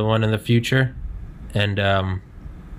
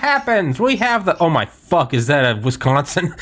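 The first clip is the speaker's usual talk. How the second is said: excited; 9 semitones higher, 4 dB louder than usual. Real, not acted. Excited joking outburst.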